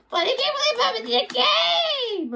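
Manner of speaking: high-pitched excited squeal; overexcited. Acted. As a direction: burst out squealing in disbelief. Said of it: "Chill, everyone".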